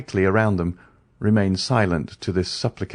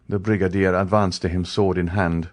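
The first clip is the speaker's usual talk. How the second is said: plain reading voice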